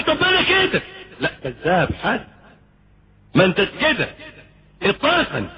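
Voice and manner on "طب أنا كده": mock listener protest.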